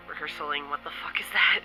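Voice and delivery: ordinary talk, nothing marked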